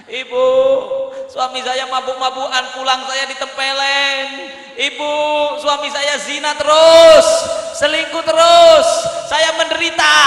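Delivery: hammed-up lament, swelling into shouts; mock tearful daughter. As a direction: act out a weepy complaint to mother. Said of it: "telepon ibunya nangis".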